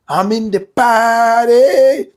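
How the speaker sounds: loud, high, long-drawn cheer; mock party cheer. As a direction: stretch it out like an old party fan. Recalling his former party enthusiasm.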